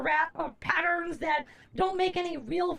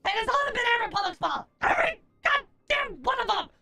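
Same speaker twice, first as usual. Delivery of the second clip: ranting; 5 semitones higher, faster than usual. Pretend. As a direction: spit out the blame. Rant against sponsor.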